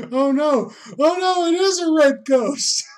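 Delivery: very high, loud fake alarm; mock panic. Joking viewer reacting to the ghost, mock panicked. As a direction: shriek in hammed-up horror.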